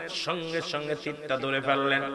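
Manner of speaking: ordinary talk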